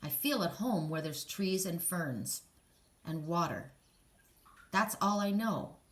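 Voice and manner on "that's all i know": normal talking voice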